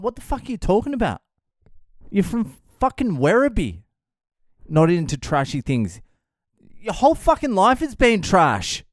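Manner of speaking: mock outrage, the pitch jumping up; incredulous retort.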